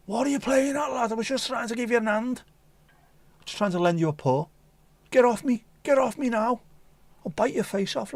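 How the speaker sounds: high Scouse scally voice, cheeky then snarling; cat turned Liverpool scally. Pretend. Scouse cat snapping at its owner, high Scouse voice. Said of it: "to being like a proper scally scouse cat".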